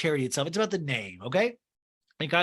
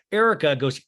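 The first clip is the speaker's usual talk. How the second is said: ordinary talk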